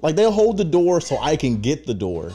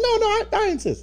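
even higher, squeakier insisting; higher polite insist. Retold or imitated. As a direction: push the politeness way up.

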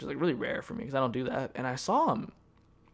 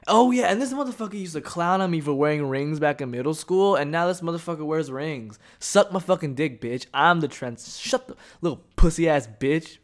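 loud, riled-up venting; indignant rant; 4 semitones higher, 6 dB louder than usual. Real, not acted. Ranting at former school tormentor.

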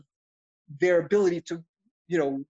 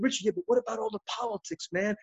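higher, softer worried protest; re-enacting an unsure plea, pitched up; retold younger self pleading; 4 semitones higher, 4 dB quieter than usual. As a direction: put your doubts to a mentor, high and quick at first. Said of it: "I said to Richard Pryor".

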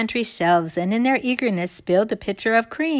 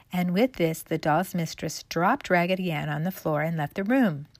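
usual speaking voice

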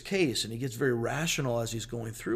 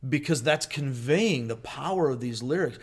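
ordinary talk

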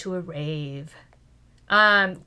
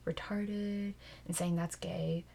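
whispering; 3 semitones lower, 8 dB quieter, slower than usual. Real, not acted. Sensitive topic, hushed.

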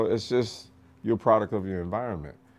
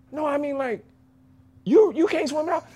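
drill instructor. Retold or imitated. Drill instructor questioning recruit.

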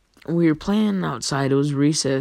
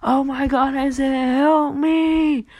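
high, mock panicked plea; a friend pinned under kids, high and pleading; crying for help; 9 semitones higher, 3 dB louder, slower than usual. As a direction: beg for rescue from under the pile. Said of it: "all I hear is".

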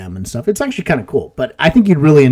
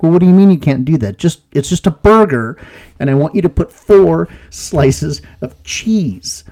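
louder, rising irritation; annoyed customer; 5 semitones higher, 4 dB louder than usual. Retold or imitated. Frustrated customer pushing back at the drive-through attendant, louder. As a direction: push back, starting to lose patience.

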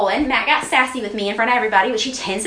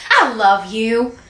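slow loving husband; 4 dB louder, much slower than usual. Retold or imitated. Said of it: "He'll be like".

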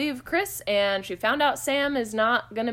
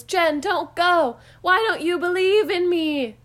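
slow, higher, louder pleading; mock plea; 8 semitones higher, 5 dB louder than usual. Host retelling Santa's son chasing Jen, hammed-up pleading. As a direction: stretch out a hammed-up plea to stay.

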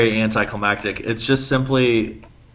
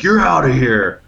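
mildly raised bouncer's order; curt ejection; 4 semitones higher, 6 dB louder than usual. Retold or imitated. Bouncer kicking out a drunk buddy, firm and loud. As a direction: throw him out, loud and firm.

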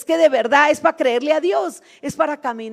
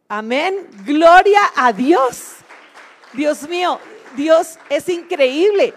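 slow, louder praise, stretched out; jubilant praise; 4 semitones higher, 5 dB louder, slower than usual. Real, not acted. Praising God to the congregation, slow jubilant praise. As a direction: savour the praise as a triumph.

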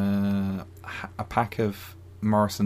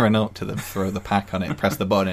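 ordinary talk

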